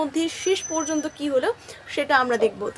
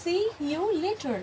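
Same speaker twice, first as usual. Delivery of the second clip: very slow and softer; drawn out gently for learners; teacher modelling English slowly and softly; slow soft model phrase; 3 semitones higher, 7 dB quieter, much slower than usual. Shown as a demonstration. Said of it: "কি বলবে".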